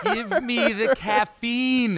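high, drawn-out desperate yell; yelled begging. Pretend. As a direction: beg at full volume, stretching the words. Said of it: "we're yelling at the microphone".

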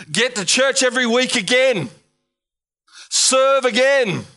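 pastor urging congregation again; preaching. Acted. Preacher exhorts church.

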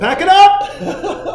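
high, slow, laughing mock exasperation; dad laughing at his daughter's pick, high and slow. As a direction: laugh it out like giving up. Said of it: "I'm like".

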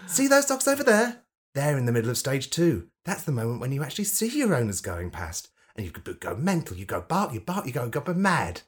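funny camp voice. Acted. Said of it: "I did it in a funny camp voice".

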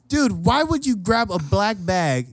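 high, slow, incredulous scolding; mock exasperated. Host scolding cousin about bag, mock exasperated. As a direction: stretch it out in pained disbelief.

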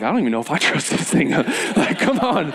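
laughing through the words. Real, not acted. Counselor admitting he doesn't trust the zip line, laughing. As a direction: chuckle at your own doubt. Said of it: "laughs at own doubt".

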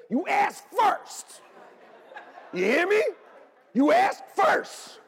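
high, loud, slow playful warning; mock stern father. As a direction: lay down the rule like a joking strict dad. Joking strict father rule.

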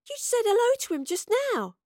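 very high, incredulous insistence; insist, voice pushed right up; insistent. A narrator's reading. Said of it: "Astrid insisted".

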